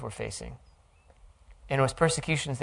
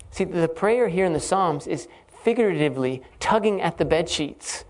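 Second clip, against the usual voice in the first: normal talking voice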